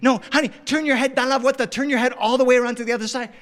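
high, loud parental insistence; frantic urging. Retold or imitated. Dad directing daughter to the rainbow, high frantic urging. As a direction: imitate a dad rushing out directions.

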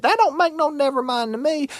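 put-on shrill-free high old woman brushing it off; high granny voice. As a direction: toss it off like a stubborn old woman.

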